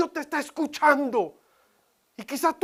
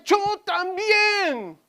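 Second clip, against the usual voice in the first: plaintive cry; 8 semitones higher, 4 dB louder, much slower than usual. Acted. Crying voice of the boy.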